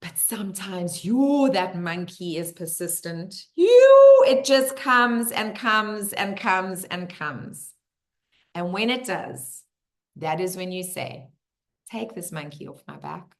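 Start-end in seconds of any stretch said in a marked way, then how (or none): 3.5-4.2 high, sustained "yoo" hoot; loud monkey whoop. Acted. Imitates the persistent monkey. 11.9-13.3 soft, low release; quiet surrender. Shown as a demonstration. Models handing the monkey over.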